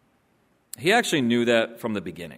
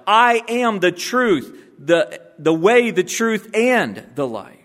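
1 fervent preaching; 7 semitones higher, 4 dB louder, slower than usual. Acted. Quoting Jesus, rising fervour.

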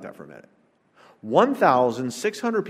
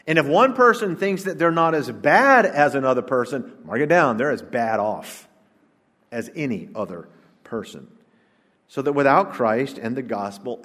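ordinary delivery

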